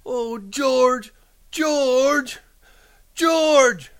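very high, drawn-out calling; desperate cry. A narrator's reading. Lenny calling George to rescue him, desperate high cry. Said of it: "And he cried".

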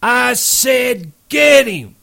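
high, slow insistent yell. Acted. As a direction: drag out a frantic yell. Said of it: "George yelled again".

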